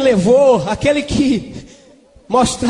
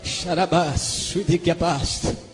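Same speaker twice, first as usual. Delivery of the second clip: low, slow, soft glossolalia; speaking in tongues; 7 semitones lower, 8 dB quieter, slower than usual. Real, not acted. Preacher praying in tongues between phrases, speaking in tongues. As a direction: sink into drawn-out quiet prayer syllables.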